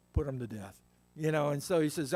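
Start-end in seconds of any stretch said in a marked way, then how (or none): 0.1-0.8 low, cold verdict from a dying king; a lightly voiced dying king passing sentence; grim order. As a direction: drop the voice down for the order. David's order retold.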